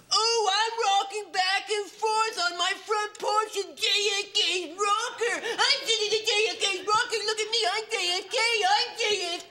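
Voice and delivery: very high, laughing gloat; giddy buyer. Acted. Buyer squeals about sitting in JFK's rocker. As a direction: squeal with delight over the rocker.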